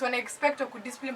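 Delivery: usual speaking voice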